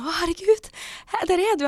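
high, loud burst of delighted surprise; excited exclamation. Retold or imitated. Woman reliving running into the man she followed, excited exclamation. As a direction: cry out as if suddenly spotting someone. Acted cry on meeting him.

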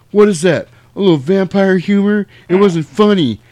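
mocking scoff; snarky retort. Corey Haim retorts.

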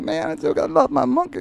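high squeaky mock-tearful wail; mock grieving Pryor. Teller voices Pryor missing his dead monkey, high and whiny. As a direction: pitch it way up, whining over the monkey. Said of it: "And I'm like".

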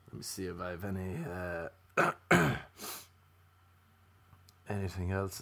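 muttering to self. Real, not acted. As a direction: mumble while looking something up. Mumbling while checking.

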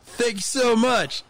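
high, drawn-out mock fan thanks; gushing thanks. Fan thanking Hulk Hogan before escaping, gushing. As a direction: stretch out an overdone, high-pitched thank-you. Acts out hurried thanks, then leaving.

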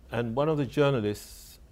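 usual speaking voice

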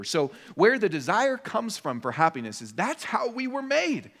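ordinary talk

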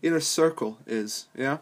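ordinary talk

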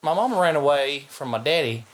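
put-on sad-sack Georgia country accent; hammed-up Conyers country accent; imitated rural Georgia accent. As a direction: do a mock Georgia accent for his sad life story. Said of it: "deep area of sadness in his soul".

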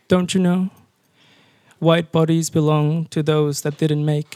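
measured poem recital with long pauses between lines; slightly lifted, deliberate poet's delivery; poetry reading. Acted. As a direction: read each line deliberately and let it hang. Reads own poem aloud.

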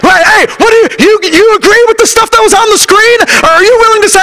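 put-on rally the young people from the pulpit; preaching at full force. Preacher exhorting youth.

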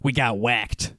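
lightly voiced county official; a hint of a gruff official; Steve Okonek voice. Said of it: "I'm gonna do my Steve Okonek voice now".

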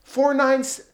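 high-pitched, disbelieving repeat of a charge; incredulous. Retold or imitated. Dad hears the charge over the police phone call, incredulous. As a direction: drag out each word in rising disbelief. Dad reacts to riot citation.

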